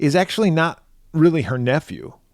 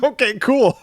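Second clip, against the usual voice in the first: put-on little kid turned gruff; 9 semitones higher than usual. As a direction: squeak it out like a kid playing tough. Boy voiced as gruff adult.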